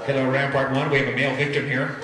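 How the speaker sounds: loud, official radio call to base; paramedic on the radio. Acted. Paramedic calling Rampart base, loud official radio voice. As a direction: report the victim like a paramedic on the radio.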